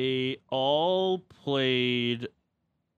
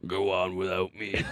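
overacted death scene; 5 semitones lower than usual. Mock dying soldier after grenade joke.

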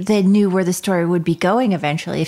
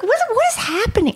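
surprised; 14 semitones higher than usual. Retold or imitated. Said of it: "I was like".

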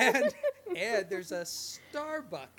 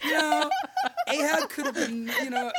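laughing; 11 semitones higher, 11 dB louder than usual. Real, not acted. Laughing defence of accent.

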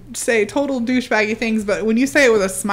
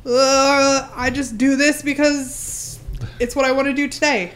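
lightly mocked flighty dude, pitched higher; 6 semitones higher than usual. Imagining the castmate explaining himself, mildly mocking flighty voice. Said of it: "kind of a flighty dude".

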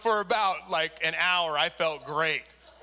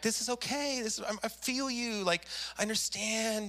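soothing tempter; 4 semitones higher, 5 dB quieter than usual. Retold or imitated. Flesh voiced as soothing tempter.

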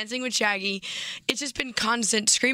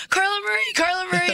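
calling out; 10 semitones higher, 4 dB louder than usual. Retold or imitated. Fans calling her name.

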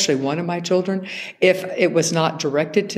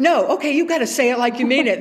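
higher mock scolding parent; mock-stern, quick, a bit higher; exasperated parent; 9 semitones higher, faster than usual. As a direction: parent demanding a real apology, hammed-up. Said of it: "And you go".

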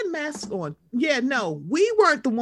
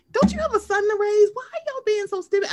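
high-pitched mock exasperation; acted incredulous scolding; 9 semitones higher than usual. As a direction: scold her in a high, disbelieving voice. Acts out scolding a girl.

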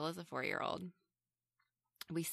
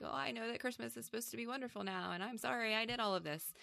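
higher, softer and fast, mock glib apology; mocking imitation; 6 semitones higher, 4 dB quieter, faster than usual. Reviewer parodying the hero's speech on the show, quick higher mock sincerity. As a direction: rattle off a hollow change of heart. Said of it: "commandeers the show and is like".

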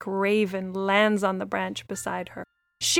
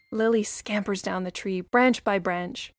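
plain reading voice

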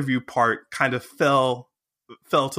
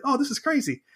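excited; 11 semitones higher, faster than usual. Retold or imitated. Fan geeking out.

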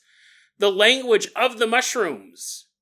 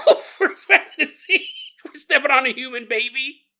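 laughing through the words, pitched up; 5 semitones higher, 3 dB louder than usual. Real, not acted. Host amused by his own absurd story, laughing as he talks. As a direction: crack up while telling it.

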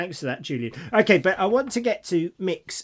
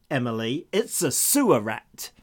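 put-on vague American accent; 3 semitones lower, 3 dB louder than usual. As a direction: read the poem line in a loose American accent, as if from Texas. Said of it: "I don't know what sort of accent that was".

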